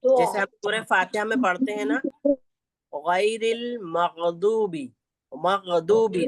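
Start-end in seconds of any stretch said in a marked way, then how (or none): 2.9-6.3 गैरिल मगदूबी dibaca lambat, gain dari pangkal tenggorokan, dad tebal; Quranic recitation. Shown as a demonstration. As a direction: recite slowly with a heavy dad. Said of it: "तो गैन की अदायगी".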